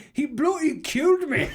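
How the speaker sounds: loud, high-pitched Scottish yell; thick Scottish accent. Retold or imitated. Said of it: "in a thick Scottish accent".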